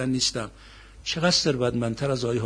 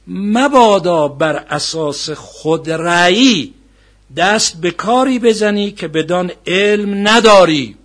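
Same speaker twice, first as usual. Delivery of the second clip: very loud, slow admonition; forceful preaching recitation; 5 semitones higher, 12 dB louder, much slower than usual. Acted. Preacher warning against acting without knowledge, forceful recitation. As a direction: declaim the precept with drawn-out weight.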